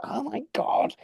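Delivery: awed breathless whisper. Retold or imitated. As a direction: whisper it in disbelief. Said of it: "I was like".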